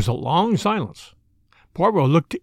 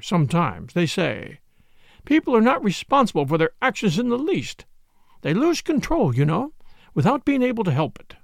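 high, lilting genteel chatter; fussy matron, self-pitying; 6 semitones higher than usual. Acted. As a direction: ramble on, all wounded virtue.

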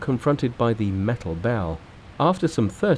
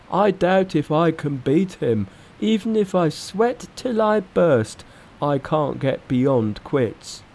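raised pig character voice, slowing at the end; Pigsy, discouraged; 7 semitones higher than usual. A narrator's reading. Pig disciple owning up he cannot win, discouraged. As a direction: admit defeat in a higher voice.

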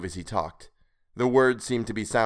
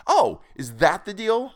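high, loud, knowing question; mocking realization; 11 semitones higher, 5 dB louder than usual. A narrator's reading. Pursuer catching the creature's slip, mocking. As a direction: pounce on the slip.

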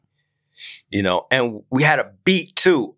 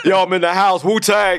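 a hint of Rizza, pitched up a little; Rizza impression; 7 semitones higher than usual. Friend imitating Rizza to fans, lightly raised voice. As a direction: toss it off like Rizza hyping a crowd. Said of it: "No, he's doing rizza".

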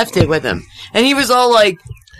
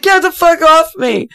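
higher and louder, half-mock yell at the raccoon; retold yell; 10 semitones higher, 4 dB louder than usual. Yelling at the raccoon gripping the sweatshirt, half-mock. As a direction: shout it off like it's clinging to you. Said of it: "I was like".

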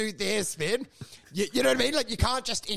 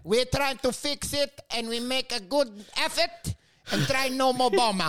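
higher mock Ukrainian accent; hammed-up broken English with a Ukrainian accent; Zelensky; 4 semitones higher than usual. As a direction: put on a thick Ukrainian accent. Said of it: "because his accent's there".

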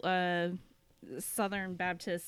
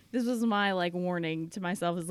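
usual speaking voice

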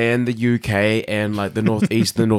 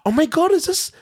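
high-pitched retold disbelief; astonished realisation; 8 semitones higher than usual. Host reliving realisation about Geordies and coats, astonished. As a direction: jump up high as it dawns on you.

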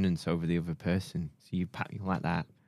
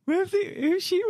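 mock shocked old lady. Mock shocked squeal at clip.

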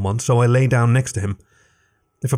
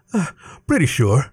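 a touch out of breath, higher voice; lightly panting answer; 4 semitones higher than usual. A narrator's reading. Leif to Alec on the trail: mildly panting. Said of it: "He panted".

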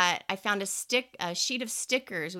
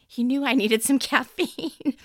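laughing; 5 semitones higher, 7 dB louder than usual. Real, not acted. Laughing at own story.

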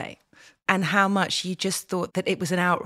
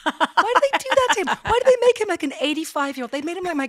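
very high, louder disbelief; incredulous; 10 semitones higher, 5 dB louder, faster than usual. Real, not acted. Complaining about Miranda's husband, high incredulous rant. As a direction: throw up your hands in disbelief.

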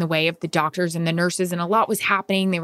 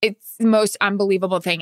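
normal talking voice

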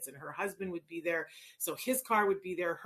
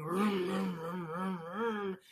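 toy car vroom; much slower than usual. Shown as a demonstration. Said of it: "I'm going".

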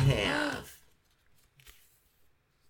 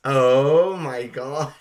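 amazed; 3 semitones higher, 7 dB louder, much slower than usual. Real, not acted. Amazed reaction.

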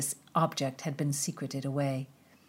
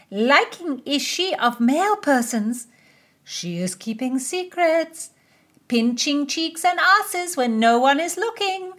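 gossiping lady; 12 semitones higher, 8 dB louder than usual. Acted. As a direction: relish the scandal in a high voice. Women gossip about the queen.

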